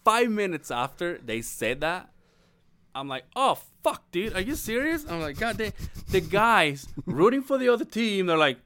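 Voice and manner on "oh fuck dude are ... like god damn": dismayed surprise. Retold or imitated. Realizing jersey is backwards.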